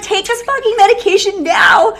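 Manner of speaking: loud, high, commanding; doctor shouting the cure; forceful doctor. Retold or imitated. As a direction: demand they take it now. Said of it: "he's gonna be like".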